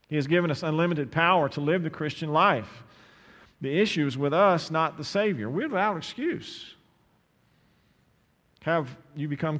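loud, rising preacher's call; fiery sermon climax; preaching at full force. Acted. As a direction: press the church hard, full voice.

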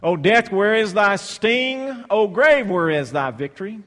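proclaimed scripture. Acted. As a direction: call it out like a triumphant hymn. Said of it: "It's almost like it's his, his, his song".

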